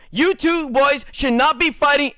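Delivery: scolding teacher. Retold or imitated.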